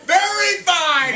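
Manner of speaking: high, loud, slow quote of a guy; mock echo of an audience member's answer; mimicking audience member. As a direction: parrot the guy in a lifted, drawn-out voice. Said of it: "he says".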